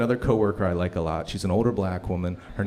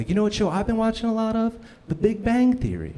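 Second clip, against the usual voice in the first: high, sweet, innocent coworker voice; 11 semitones higher than usual. Retold or imitated. Coworker sharing her TV show, in a high sweet voice. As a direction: play her guileless and chirpy, pitched way up.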